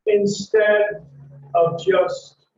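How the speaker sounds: ordinary talk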